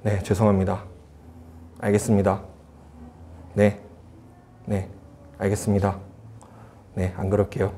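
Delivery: low, subdued replies with pauses between; suppressed, submissive apology. Retold or imitated. Angry son yields to mother on the phone, subdued. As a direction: swallow the anger and give in quietly. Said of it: "억누르고".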